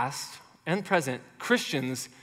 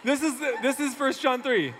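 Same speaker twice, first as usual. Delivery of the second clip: normal talking voice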